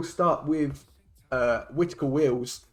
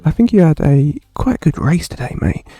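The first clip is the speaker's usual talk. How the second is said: mock seductive voice; 2 semitones higher, 13 dB louder than usual. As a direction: put on a hammy seductive tone. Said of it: "how about I just talk seductively to you".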